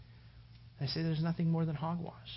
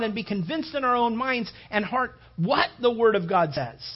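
higher, louder, impassioned pulpit voice; fervent sermon delivery, voice raised; full-force preaching; 7 semitones higher, 8 dB louder than usual. Acted. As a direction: preach it hard to the congregation.

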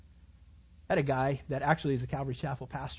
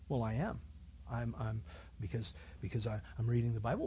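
lower, softer unsure stammer; mock hesitant reply, lower and softer; stammering Calvinist; 5 semitones lower, 6 dB quieter than usual. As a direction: fumble it like a caught-out believer.

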